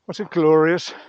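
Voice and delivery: usual speaking voice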